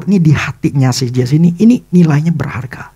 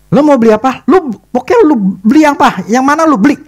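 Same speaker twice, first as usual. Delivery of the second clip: much higher, insistent offer; eager urging; 10 semitones higher, 4 dB louder than usual. Retold or imitated. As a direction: push him to pick something. Wife pressing him to buy.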